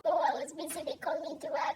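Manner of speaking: high put-on whiny sister voice; mock her flimsy excuse. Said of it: "She's like".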